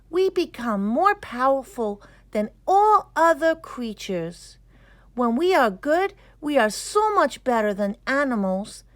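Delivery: put-on a thoughtful boy working out a big idea aloud; young boy Mustafa. Mustafa voiced in a story.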